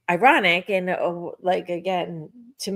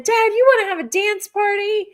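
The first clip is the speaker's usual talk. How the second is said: high, fast, playful and a bit louder; excited invitation; 12 semitones higher, 4 dB louder, faster than usual. Retold or imitated. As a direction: bounce it out like a kid asking to play. Invites late dad to dance.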